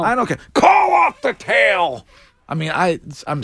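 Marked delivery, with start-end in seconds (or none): 0.0-2.1 mock movie shout. Host quoting The Departed to defend it, mock shouting. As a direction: bellow it like an over-the-top movie line. Quoting a film line insistently.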